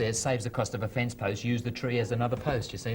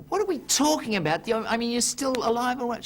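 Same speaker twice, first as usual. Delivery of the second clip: much higher, louder indignant husband; higher mock outrage; 12 semitones higher, 4 dB louder than usual. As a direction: protest at the question. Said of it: "he said".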